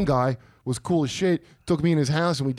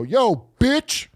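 shouted taunt; 7 semitones higher, 3 dB louder than usual. Retold or imitated. Guy yelling from the street.

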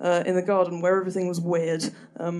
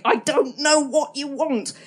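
higher, slow, drawn-out exasperation at the cat; exasperated at deaf cat; 7 semitones higher, slower than usual. Retold or imitated. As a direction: plead helplessly with a deaf cat, slowly. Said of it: "just me opposite the cat going".

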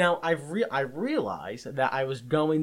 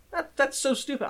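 exasperated; 11 semitones higher than usual. Retold or imitated. His exasperated reply retold.

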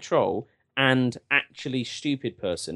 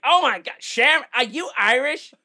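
gushing American; 11 semitones higher than usual. Retold or imitated. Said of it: "they're like".

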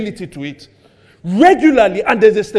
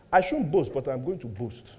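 low, much softer voice; low-key quoted confession; 10 semitones lower, 12 dB quieter than usual. Acted. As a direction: drop pitch and volume for the quote. Quoting Paul's reluctant boast.

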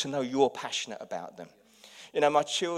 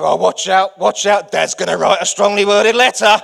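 loud, high mock warning; teasing children; 4 semitones higher, 16 dB louder than usual. Children mocking their father at dinner, loud teasing. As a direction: tease dad with a singsong jibe. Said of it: "the children will go".

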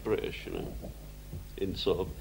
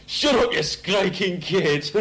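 put-on old Salford barman yelling at a crying baby, high and slow; grumpy barman's yell; 10 semitones higher, 5 dB louder, much slower than usual. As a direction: bark it out like a fed-up publican. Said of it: "he comes out and goes".

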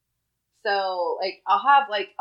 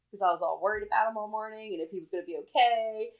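usual speaking voice

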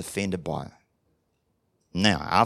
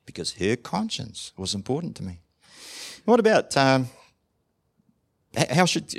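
ordinary talk

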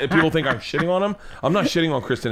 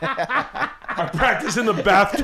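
slow, higher, laughing mimicry; mocking imitation; 7 semitones higher, much slower than usual. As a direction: parrot the friend's boast. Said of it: "mocks friend's bathtub claim".

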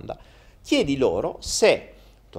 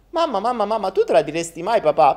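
put-on childish boy voice; 8 semitones higher, 3 dB louder than usual. Boy asks mother.

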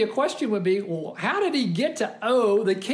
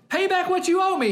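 sharp demand, much higher and louder; angry demand; 11 semitones higher, 4 dB louder than usual. Acted. Servant choking his fellow servant, angry high demand. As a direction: bark the demand at the debtor. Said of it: "he demanded".

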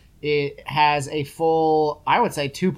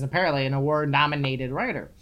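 usual speaking voice

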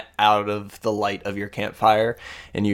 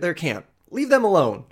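higher-pitched scolding of a pet; calling off a creature; 9 semitones higher than usual. Acted. Woman calls her creature away from the camp, scolding. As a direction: call it off, pitched up.

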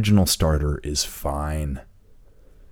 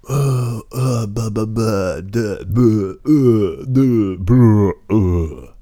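higher, loud dopey stammering noises; mock dumbfounded babbling; 5 semitones higher, 5 dB louder, much slower than usual. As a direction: mimic tongue-tied culprits with moronic babble. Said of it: "don't even know what their response was, except like".

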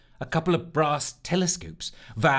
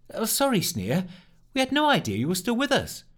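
surprised; 9 semitones higher than usual. Acted. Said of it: "also surprised".

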